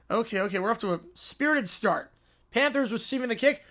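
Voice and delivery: ordinary talk, nothing marked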